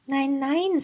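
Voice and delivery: put-on high-pitched child's refusal; lightly voiced girl saying no; girl objecting. A narrator's reading. Gretel refuses her brother's offer.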